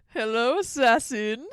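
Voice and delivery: loud mock villain hello; villain greeting. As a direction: greet like a gloating boss. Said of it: "he was going".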